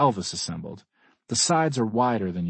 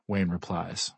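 usual speaking voice

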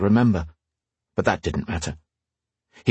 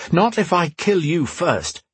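defiant; 8 semitones higher than usual. Acted. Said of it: "Roan declared".

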